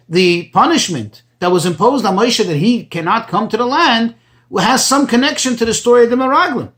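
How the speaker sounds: usual speaking voice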